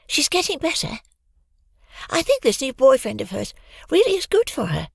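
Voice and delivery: put-on high, bright young girl. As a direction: voice Hoggy high and light. Narrator voices Hoggy.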